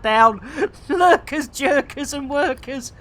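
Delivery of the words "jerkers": ordinary delivery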